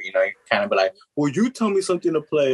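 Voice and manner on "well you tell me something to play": mock butthurt comeback, pitched up. Coworker challenged over his music picks, mock butthurt. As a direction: imitate someone a bit offended, voice raised in pitch. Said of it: "like, butthurt".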